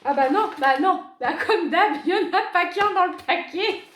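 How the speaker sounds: amused and loud; laughing. Real, not acted. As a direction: laugh through the words. Laughing while unwrapping.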